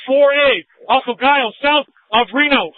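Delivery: shouted location over radio; urgent shouting. Real, not acted. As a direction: yell it out loud and urgent. Officer shouting location amid gunfire.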